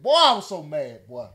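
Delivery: ordinary talk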